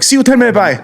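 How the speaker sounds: high-pitched mock Chinese-accented sign-off; imitated Chinese accent. Chinese restaurant worker ending a phone order, imitated Chinese accent. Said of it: "I order from the same Chinese restaurant".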